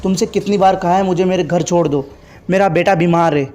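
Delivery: ordinary delivery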